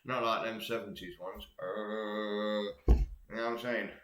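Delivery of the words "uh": zombie impression. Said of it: "That was my zombie impression".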